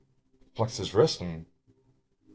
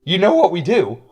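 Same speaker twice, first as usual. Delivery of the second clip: lightly silly voice, loud, starting high and settling down; stupid voice; 5 semitones higher, 7 dB louder than usual. Acted. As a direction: defend yourself in a mildly silly loud voice. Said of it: "that stupid voice".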